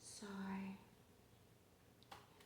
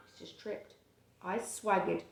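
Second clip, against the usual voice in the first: ordinary delivery, nothing marked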